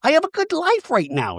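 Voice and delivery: mock proud, a bit fast; Shannon impression. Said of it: "Shannon in a talking head's like".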